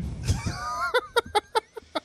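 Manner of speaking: high, loose cackle; real high-pitched laugh; burst of laughter. Real, not acted. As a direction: crack up at your own joke. Laughing at the meter joke.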